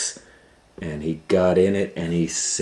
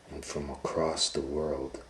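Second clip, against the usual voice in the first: ordinary delivery, nothing marked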